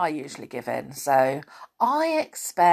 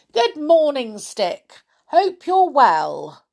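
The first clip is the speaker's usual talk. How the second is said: higher, louder jolly greeting; cheerful Saucepan Man; 9 semitones higher, 7 dB louder, slower than usual. Acted. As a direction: greet the boy warmly and loudly. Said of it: "said the Saucepan Man".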